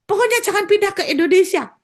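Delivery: preacher shouts the spirit out of the country; forceful command. Retold or imitated. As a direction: blurt the ban with full force. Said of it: "Langsung saya bilang gini".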